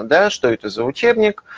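normal talking voice

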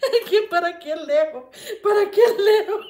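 giggling through the question; laughing fit. Real, not acted. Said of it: "Yo me tiro risada".

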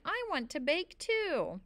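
high, drawn-out plea; a left-out child's slow, high cry; plaintive cry. A narrator's reading. Boy left in the tree begs to join, crying out. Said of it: "I cried".

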